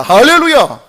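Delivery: loud, high preacher's hallelujah; triumphant pulpit shout; exultant shout. Real, not acted. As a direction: cry out in praise to the congregation. Preacher exclaims at a miracle.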